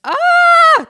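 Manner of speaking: sudden loud high scream of frustration; a loud frustrated shriek into the camera. Real, not acted. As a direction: let out a raw shriek of pent-up frustration. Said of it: "whoever had to hear that".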